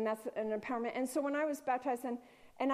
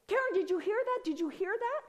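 much higher, louder eager question; excited call; 9 semitones higher, 4 dB louder than usual. Retold or imitated. Husband calling Karen in the shower, excited and loud. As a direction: call out urgently to your wife.

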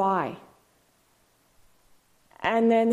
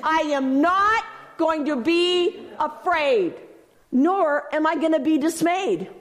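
higher, slow, leaning on "not"; preacher models the answer for the crowd; slow declaration; 6 semitones higher than usual. Shown as a demonstration. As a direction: declare it slowly with "not" stressed. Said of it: "What are we gonna do".

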